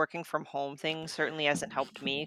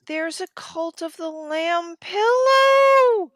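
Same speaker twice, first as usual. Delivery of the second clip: loud, slow delighted exclaim; excited; 12 semitones higher, 8 dB louder, slower than usual. Real, not acted. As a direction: announce the find with glee. Excited at spotting merch.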